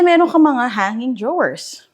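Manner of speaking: ordinary talk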